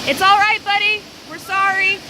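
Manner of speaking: soothing. Real, not acted. Apologizing to the porcupine.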